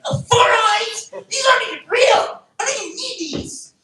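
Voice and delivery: very high strained mock yell; indignant mock retort. Comic answering four-eyes taunts, shrieky mock outrage. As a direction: squeal back at the teasing, hammed-up. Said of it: "that's how you counter, like, four eyes comments".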